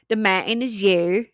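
jokey Utah accent on the title. Shown as a demonstration. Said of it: "Sorry, I have the Utah accent".

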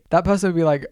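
ordinary talk

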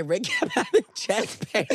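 mock-dramatic complaint broken by laughter; laughing while quoting. Retelling a friend's chest-pain drama, laughing. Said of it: "I've never had Denzel say".

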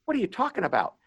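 high, baffled retold question; incredulous. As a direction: react in disbelief to the accusation. Retold baffled reply to client.

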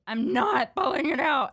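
put-on high, loud kid protest; defiant retold scream. Little sister refusing to pull it out, defiant retold scream. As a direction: belt out a mock-panicked refusal.

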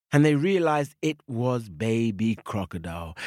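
plain reading voice

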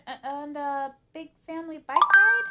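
halting start, then very loud, high offer; desperately eager. Acted. Arthur stalling Mom from the computer, desperately eager. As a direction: push the idea with frantic cheer. Guilty child distracting mother.

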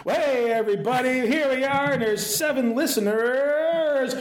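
high, hyped-up host intro voice; cheesy radio host. Retold or imitated. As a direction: play the overexcited podcast host. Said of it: "they start the thing and they go".

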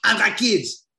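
high, loud mock Saint Lucian accent; Dan Sammy. Podcaster quoting Dan Sammy's plea, hammed-up Saint Lucian accent. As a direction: put on a badly faked Saint Lucian accent. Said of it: "Of course, not in that voice".

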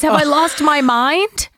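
high, drawn-out bewildered question; going crazy. Retold or imitated. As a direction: act out her doubting her own sanity. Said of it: "she thinks now she's going crazy".

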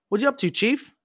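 high, loud mock-friendly greeting from a superior; mock master chief. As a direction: ham up a cheery boss asking what's going on.

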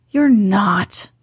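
curt, unsympathetic contradiction; Mary dismissing a sick boy's complaint, unsympathetic. Acted. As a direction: flatly brush off his self-pity. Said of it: "contradicted Mary unsympathetically".

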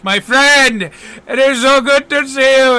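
high, loud, delighted welcome; big-hearted acted exuberance; effusive greeting. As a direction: hail a long-lost friend with open arms. Delighted character greets old friend.

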